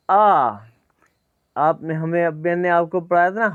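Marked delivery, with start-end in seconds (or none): none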